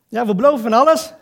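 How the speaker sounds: loud, laughing mock of politicians promising everything; mocking shout. Preacher mocking politicians to his congregation, loud laughing jeer. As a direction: jeer it out like a crowd-pleasing politician.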